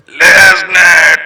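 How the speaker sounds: loud, high ringing yell through the filter; shouted name. Pretend. Testing the voice filter, a loud ringing yell. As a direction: bellow it like a ring announcer.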